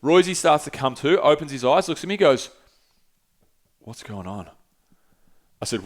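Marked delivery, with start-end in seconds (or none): 3.8-4.5 dazed and quiet. Retold or imitated. Friend waking from choke.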